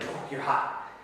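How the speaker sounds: understated whispered admiration; small impressed aside. Shown as a demonstration. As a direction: murmur it, mildly impressed. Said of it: "You could just do like a".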